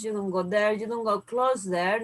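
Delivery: ordinary talk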